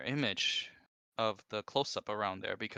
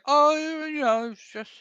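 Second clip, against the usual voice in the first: very high, loud squeaky mock voice; frazzled and silly from no sleep; [name]'s exhausted voice; 13 semitones higher, 7 dB louder than usual. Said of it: "why are you talking like that?".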